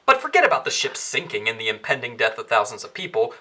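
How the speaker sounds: ordinary talk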